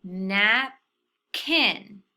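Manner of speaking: napkin drawn out slowly, syllables eased together; slow blended napkin. Shown as a demonstration. Teacher slowly blending napkin for students. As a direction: stretch napkin as you blend the parts. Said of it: "let's try to put both of those syllables together".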